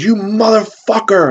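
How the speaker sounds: high, drawn-out mock outrage; mock mad. Captain cursing the cop who kissed him, mock mad. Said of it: "makes his face like he's mad".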